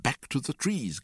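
high-pitched indignant yelp; squawked parting slogan. A narrator's reading. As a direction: yelp it as a battle cry. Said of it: "glapit oncle Vania".

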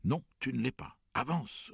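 high, loud, forceful order; roaring. A narrator's reading. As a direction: roar at a straggler to move. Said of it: "rugit Père". (rugissement autoritaire, voix haute et forte)

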